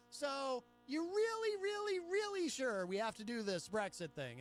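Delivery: incredulous questioning. Acted. Ballot question to British voters on Brexit, high incredulous plea. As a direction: plead with them to think twice.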